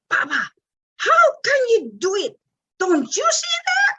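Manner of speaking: put-on high, loud child scolding her papa; a small girl, hands on hips, telling off her dad; imitating the child scolding. Seven-year-old daughter scolds her papa, copying her teacher, high loud scold. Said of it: "dia begini nih".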